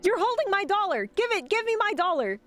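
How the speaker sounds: high, sharp protest; indignant protest. Real, not acted. Streamer to the vendor, high indignant protest. Refusing, demanding dollar back.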